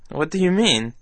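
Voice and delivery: ordinary talk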